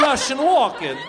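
high, loud Russian-accented celebrity impression; hammy bad Russian take on a film star; Christopher Walken. Shown as a demonstration. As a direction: punch it out as a Russianized star impersonation. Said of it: "starting to sound like Christopher Walken".

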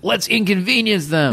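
high, mocking prankster voice. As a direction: sneer it as a silly kid.

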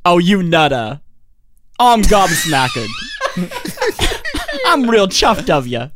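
hammed-up posh British accent. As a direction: overdo a jolly English accent. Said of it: "Is that what this is like?".

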